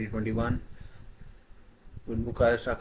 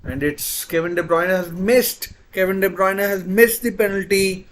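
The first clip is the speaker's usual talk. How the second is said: loud, high excited call; excited shout; 7 semitones higher, 6 dB louder than usual. Real, not acted. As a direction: shout the miss in disbelief. Big miss called live.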